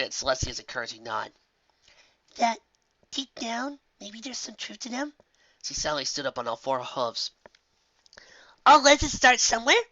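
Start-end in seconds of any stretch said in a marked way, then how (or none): 2.3-5.1 put-on high, puzzled young unicorn; young Twilight voice. A narrator's reading. Twilight continues her idea. 8.6-9.8 high, louder eureka; excited realization. A narrator's reading. Twilight jumps up excited.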